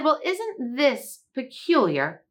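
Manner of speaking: slow, high, puzzled bee voice; wondering honeybee. Acted. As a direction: wonder at it slowly, like a curious bee. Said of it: "and she said".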